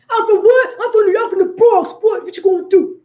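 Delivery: high, loud, daring challenge. Retold or imitated. Mocking the tough New York boast to viewers. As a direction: puff up and dare them. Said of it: "I'm not saying it with that energy".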